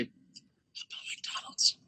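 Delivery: slow secret whisper; sneaky slow whisper. Retold or imitated. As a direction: whisper it so parents can't hear.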